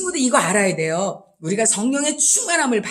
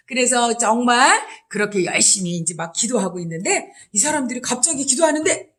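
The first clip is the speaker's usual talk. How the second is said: ordinary delivery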